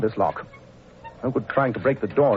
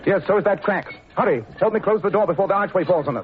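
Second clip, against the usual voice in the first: urgent alarm; 8 semitones higher than usual. Acted. Holmes urging Watson to shut the door, urgent alarm. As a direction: push the words out fast in alarm. Arch collapsing, urgent escape.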